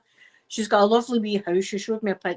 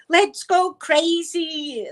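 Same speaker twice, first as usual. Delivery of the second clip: high, slow, drawn-out stage yell; rock-star cry; 8 semitones higher, 3 dB louder, much slower than usual. Retold or imitated. Fan imitating [name]'s stage call, high and slow. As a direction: stretch it out like a rocker hyping the crowd. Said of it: "like that".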